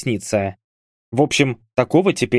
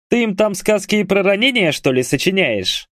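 high, raised-pitch teasing call; shouted into phone; 8 semitones higher than usual. A narrator's reading. Hamlet teasing his friend over the phone, high-pitched calling. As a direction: call out down the line. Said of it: "крикнул Гамлет в трубку".

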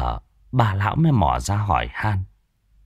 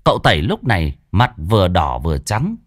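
ordinary delivery, nothing marked

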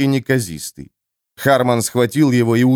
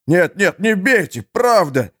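испуганная мольба; 8 semitones higher than usual. Acted. Пленник умоляет под угрозой.

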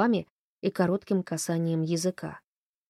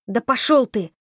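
loud, higher angry retort; rude outburst; 4 semitones higher, 7 dB louder than usual. A narrator's reading. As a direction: bite back at him. Girl curses at the man.

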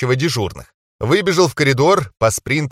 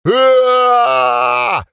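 high panicked scream; terrified scream; 11 semitones higher, 4 dB louder than usual. Acted. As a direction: scream in sheer horror. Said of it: "орали пойманные на горячем зэки".

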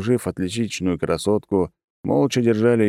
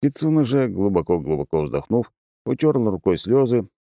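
usual speaking voice

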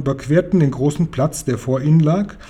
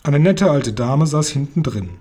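usual speaking voice

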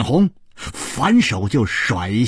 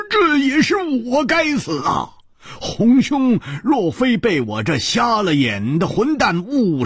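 high-pitched, agitated outburst; loud self-reproach; 13 semitones higher than usual. Acted. Hu blames himself before his rescuer, in a high agitated cry. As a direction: cry out your guilt in a high voice. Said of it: "胡铁花跳起来大声地说".

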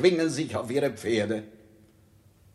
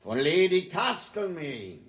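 royal proclamation; 9 semitones higher than usual. Acted. King formally proclaiming his retreat to Salisbury. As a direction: proclaim it like a herald.

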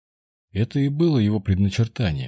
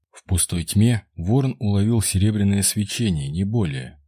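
usual speaking voice

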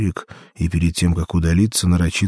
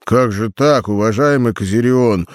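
exclaiming; 4 semitones higher, 4 dB louder than usual. A narrator's reading. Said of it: "воскликнул Ульрик".

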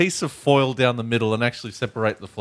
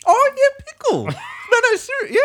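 laughing. Real, not acted. Friends laugh at pickle claim.

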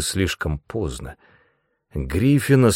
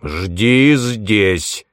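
loud, steely command; stern, forceful order; 6 dB louder than usual. A narrator's reading. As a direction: let steel show in the voice. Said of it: "В голосе старика прорезалась сталь".

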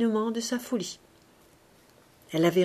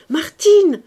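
Martine called louder; louder calling; 10 semitones higher, 14 dB louder than usual. A narrator's reading. Young woman calling again, louder. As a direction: call the name louder.

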